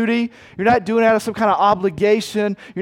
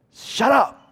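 higher-pitched curt rebuke; rebuking; 5 semitones higher than usual. Acted. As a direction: shush a noisy beggar. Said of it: "many rebuked him".

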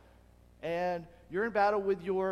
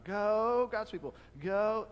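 higher cheerleader chant that doesn't mean it; half-hearted cheer; 5 semitones higher, 5 dB louder, slower than usual. Retold or imitated. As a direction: cheer on cue without conviction. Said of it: "But they don't mean it".

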